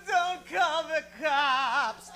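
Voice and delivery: high, slow, drawn-out mock wail; crying plea. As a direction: beg in a hammed-up sob. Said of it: "one's crying".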